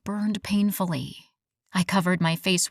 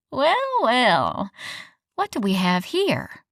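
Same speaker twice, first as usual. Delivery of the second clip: smug; 5 semitones higher than usual. A narrator's reading. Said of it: "Evie's smug voice".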